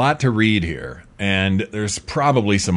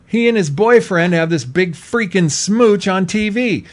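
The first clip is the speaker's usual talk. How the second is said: usual speaking voice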